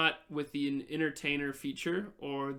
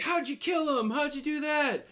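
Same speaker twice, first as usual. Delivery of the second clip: high, eager shouted questions; excited crowd clamour; 12 semitones higher, 4 dB louder than usual. Acted. Patrons quizzing goblin slayers, high excited shouting. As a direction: clamour for the story like an awed crowd.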